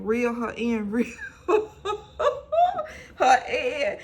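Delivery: high laugh breaking the words. Real, not acted. Retelling the scheme, high laughing break. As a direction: crack up mid-phrase.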